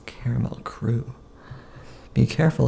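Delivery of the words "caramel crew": breathy whispered aside. Acted. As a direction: whisper it like a secret. Repeated line, second whispered.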